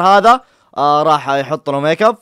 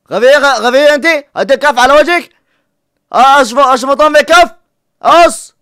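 high, loud scolding; angry yelling; 8 semitones higher, 8 dB louder than usual. Real, not acted. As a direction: shout at her in exasperation. Scolding girl on screen.

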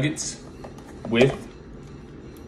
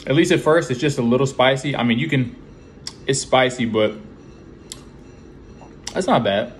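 speaking between bites; chewing while talking. Real, not acted. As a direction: mouth partly full. Seen 0: holds nugget, lips pursed between words, likely chewing while speaking.

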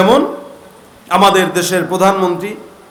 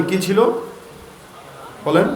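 usual speaking voice

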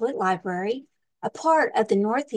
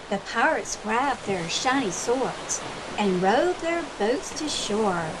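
lively storybook read-aloud with big swings; reading to children; slower than usual. Acted. Librarian reading a pirate picture book to children, lively storytime voice. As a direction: read it like storytime for little kids.

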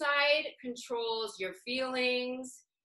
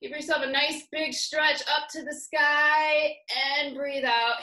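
raised, louder voice with long drawn-out words; stretching cue; 5 semitones higher, 6 dB louder than usual. Real, not acted. Teacher leading a stretch to the sky, high and drawn-out. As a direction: stretch the words upward like the body. Leads a big stretch upward.

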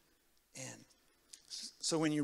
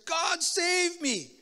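high, loud, very slow cry to God; a drowning boy pleading; drawn-out, raised plea for rescue; desperate prayer; 13 semitones higher, 8 dB louder, much slower than usual. Retold or imitated. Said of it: "somehow I just said".

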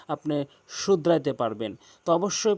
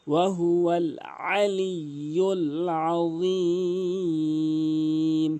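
slow melodic chant, ইল্লা lifted; Quran recitation; much slower than usual. Shown as a demonstration. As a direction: recite slowly, lifting ইল্লা.

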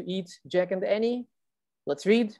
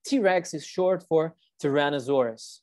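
usual speaking voice

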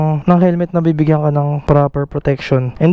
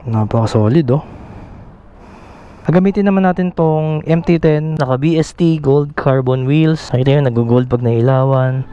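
ordinary talk, nothing marked

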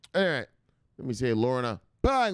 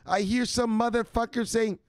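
mother; 9 semitones higher than usual. Acted. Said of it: "his mother doesn't talk like that".

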